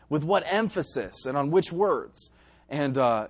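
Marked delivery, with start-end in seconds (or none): none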